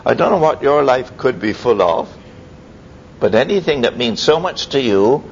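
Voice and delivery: normal talking voice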